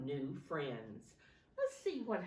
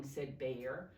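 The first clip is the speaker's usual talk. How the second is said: ordinary delivery, nothing marked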